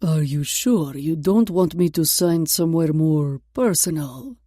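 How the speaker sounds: put-on low, slow thick Russian accent. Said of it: "His thick Russian accent".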